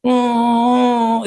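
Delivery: loud, high fake wailing cry; a hammed-up loud wail; mock wail. A narrator's reading. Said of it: "बहुत जोर जोर से रोने लगे". (ज़ोर से नकली रोना)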